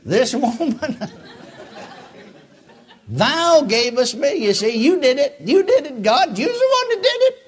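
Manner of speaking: high, loud whining accusation, laughing through; mocking Adam. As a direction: ham up Adam blaming God. Mocking Adam blaming God.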